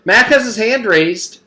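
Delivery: put-on high, loud little girl telling on a classmate; tattletale girl. A narrator's reading. Jenny tells the teacher about Mac, high tattling voice. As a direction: pipe up like a tattling kid.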